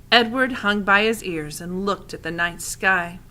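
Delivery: ordinary delivery, nothing marked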